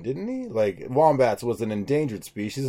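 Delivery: normal talking voice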